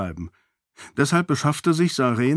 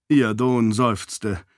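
ordinary delivery

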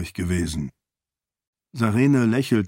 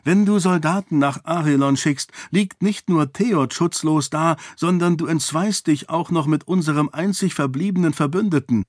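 higher, urgent reasoning; princess arguing; 6 semitones higher than usual. Acted. Daughter warns father against sending soldiers, higher urgent argument. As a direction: argue him out of it, pitched up. Heroine argues with her father.

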